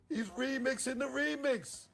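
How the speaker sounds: high, strained complaint; high outrage. Real, not acted. Complaining to [name] about [name], strained high. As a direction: push it up in disbelief.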